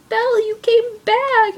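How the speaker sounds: high-pitched mock-joyful exclamation; light up as you exclaim it, imitating a film character; delighted relief. Dying Beast seeing Belle return, acted high-pitched delight. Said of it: "his whole face lights up".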